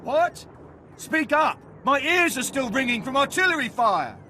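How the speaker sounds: high, shouting half-deaf soldier. Acted. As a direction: yell over ringing ears. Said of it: "If we speak too loudly".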